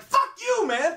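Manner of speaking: high-pitched exasperated yell; mock outrage. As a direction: burst out in put-on fury. Viewer's outburst at character.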